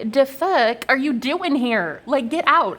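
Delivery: retold exasperation, stretching the words; indignant confrontation. Retelling reaction to invading trolls.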